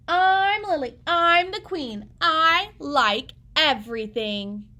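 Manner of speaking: put-on loud, high, slow proud girl; bold little queen. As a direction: proclaim like a tiny queen. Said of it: "had her own way".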